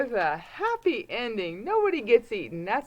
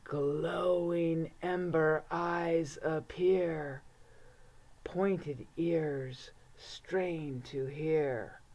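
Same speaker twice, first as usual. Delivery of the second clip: low, soft and slow; hushed suspense narration; 3 semitones lower, 7 dB quieter, much slower than usual. A narrator's reading. Reading the wolf's approach to kids, low and slow. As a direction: draw them in with quiet dread.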